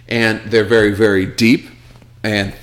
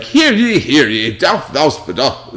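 put-on old-fashioned town crier; 4 semitones higher, slower than usual. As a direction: ham up stiff olde English formality. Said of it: "it sounds like".

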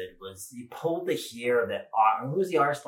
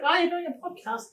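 high, breathy mum impression; man doing a woman's voice. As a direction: play the surprised mum, way up high. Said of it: "So that's my mum impression".

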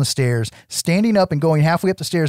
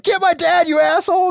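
mock yell. The heroine yelling at the officer outside, mock angry shout. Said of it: "And she's yelling at him".

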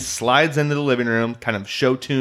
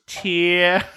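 Peter Griffin voice; 7 semitones higher than usual. Retold or imitated. Peter hiding his identity.

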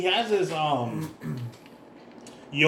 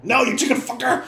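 high-pitched shouted insult; explosive, higher-pitched in-character refusal; defiant yell; 8 semitones higher, 3 dB louder than usual. Acted. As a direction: yell it back in mock outrage. Player yells defiance at cult leader.

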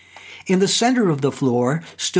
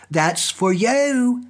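slow, higher sly gloat; malicious grin; 6 semitones higher, much slower than usual. A narrator's reading. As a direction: grin through the words slowly. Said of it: "said my guide with a malicious grin".

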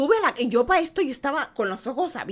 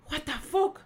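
higher, dazed retold question; baffled disbelief; 4 semitones higher, 3 dB quieter, slower than usual. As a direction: act out stunned confusion. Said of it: "Así me quedé". (incredulidad desconcertada)

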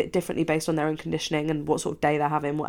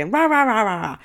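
ordinary talk, nothing marked

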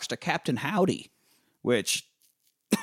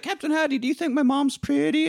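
put-on high sweet child voice; girl asking the spirit about her mom, high and sweet; little girl. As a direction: ask it like an innocent girl.

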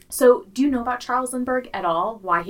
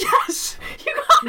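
high, loud, thrilled yell; excited; 4 dB louder than usual. Real, not acted. Host cheers the co-host's correct guess, really excited. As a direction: burst out with real delight. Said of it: "No, but I was excited".

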